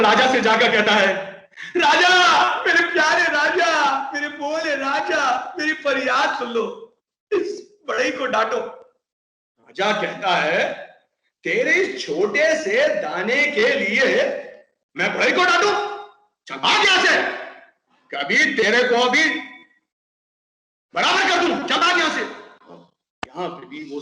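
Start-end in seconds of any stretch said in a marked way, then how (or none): 1.8-8.6 high calling plea to the king; pleading parrot. Acted. 11.4-22.2 scornful king. Acted. King dismisses parrot angrily.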